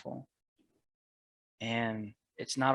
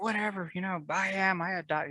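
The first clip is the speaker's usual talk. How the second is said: dismissive; 6 semitones higher than usual. Retold or imitated. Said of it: "And I'd be like".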